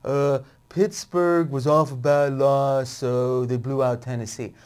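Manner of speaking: put-on dopey voice, words stretched out; dumb guy. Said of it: "this is my dumb guy voice".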